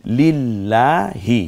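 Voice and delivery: slow drawn-out Quranic recitation; ধীরে টেনে তিলাওয়াত. Shown as a demonstration. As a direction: stretch each syllable slowly, as in tilawat. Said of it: "এই হটা গলায় ছেড়ে দিয়ে".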